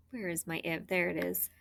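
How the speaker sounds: ordinary talk, nothing marked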